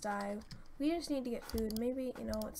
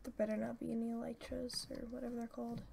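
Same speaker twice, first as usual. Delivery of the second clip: normal talking voice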